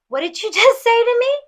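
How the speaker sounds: high, stunned repeated question with a nervous laugh; shocked broken record. Retold or imitated. Retelling the store clash, she repeats herself high and stunned with a laugh. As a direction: replay her shaken question like a stuck record. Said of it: "Like a broken record".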